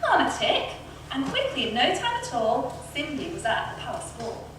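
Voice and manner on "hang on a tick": fairy. A narrator's reading. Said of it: "The fairy said".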